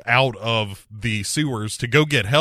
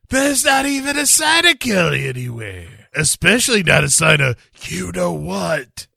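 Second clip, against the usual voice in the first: mock police chief; 6 semitones higher, 4 dB louder than usual. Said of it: "the chief shows up and tells him".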